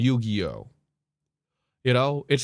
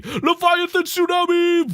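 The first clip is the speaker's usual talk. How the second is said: soaring high hammed-up battle cry; mock epic summon; 13 semitones higher, 4 dB louder than usual. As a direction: announce the monster like an anime attack. Opponent's overpowered whale summon.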